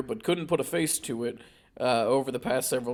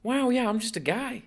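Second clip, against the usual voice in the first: Mark Wahlberg impression; 8 semitones higher than usual. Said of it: "my Mark Wahlberg word".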